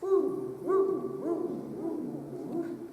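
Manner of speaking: high, swooping woos. Shown as a demonstration. Teacher showing a vocal exploration game, high swooping woos. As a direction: slide a light woo up high. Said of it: "let's do woo on this".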